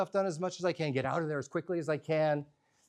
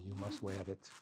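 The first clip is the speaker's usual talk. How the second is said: soft, earnest grandma plea; quiet insistence; 7 semitones lower, 12 dB quieter than usual. Retold or imitated. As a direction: say it softly, insisting gently.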